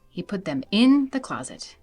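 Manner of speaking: usual speaking voice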